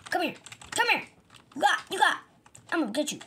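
high, loud panicked cartoon voice; frantic game character. Victim chases the thief.

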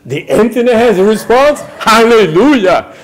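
high loud amazed question, then laughing hallelujah; delighted surprise. Real, not acted. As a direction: greet the news with joy. Surprised at online answer.

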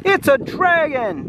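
put-on children discover the dragon, high scared shout. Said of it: "they all shouted".

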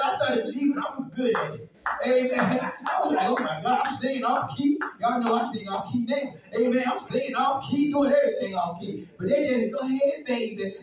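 loud, high, charged preaching; fiery preaching. Acted. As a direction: preach it at full force. Preaching at full force.

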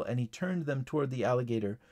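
ordinary delivery

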